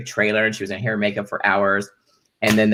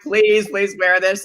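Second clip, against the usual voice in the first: mock pleading; 10 semitones higher, slower than usual. Voicing the director begging.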